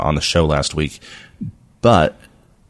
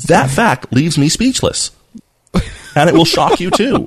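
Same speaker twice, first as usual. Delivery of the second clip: higher, hyped-up headline voice; clickbait video narrator; 10 semitones higher, 3 dB louder than usual. Pretend. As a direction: read it like shocking clickbait.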